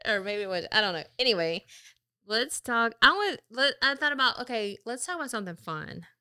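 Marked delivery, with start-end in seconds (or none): none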